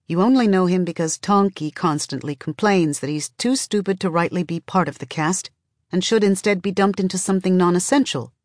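ordinary delivery

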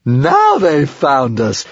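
high, very slow, drawn-out squeal; excited squeal. A narrator's reading. Stormfly delights at being found, squealing excitedly. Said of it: "squealed Stormfly in excitement".

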